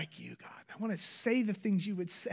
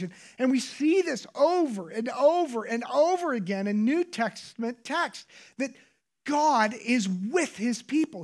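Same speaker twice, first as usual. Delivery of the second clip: higher, louder, driving preacher's voice; fervent preaching; 8 semitones higher, 10 dB louder than usual. Acted. Pastor preaching to congregation about God's presence, fervent. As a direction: preach it at full force, fast and rising.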